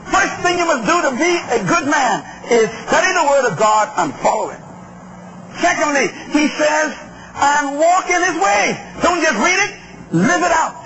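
high, emphatic scripture exposition; forceful preaching. Acted. Preacher teaching David's charge to his son, forceful preaching. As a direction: preach each instruction with stretched emphasis. Expounds scripture at full pitch.

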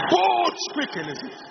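high ringing proclamation; bold exhortation. Acted. Preacher to congregation, high bold proclamation. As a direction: ring it out high. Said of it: "preacher exhorts".

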